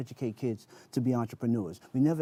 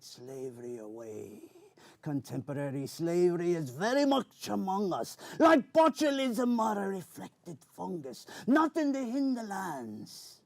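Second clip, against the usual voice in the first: Captain Barbossa pirate voice; 4 semitones higher than usual. Acted. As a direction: declaim like a swashbuckling pirate. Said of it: "characters was Captain Barbosa".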